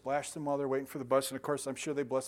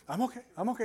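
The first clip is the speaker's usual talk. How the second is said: tag; 7 semitones higher, faster than usual. Retold or imitated. Acts reassuring daughter after fall.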